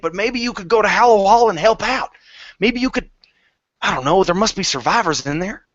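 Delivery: high, pleading voice of a shaken man; pleading NPC. Acted. NPC begging the party to go help at Hallow Hall. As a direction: beg the heroes for help, voice lifted high.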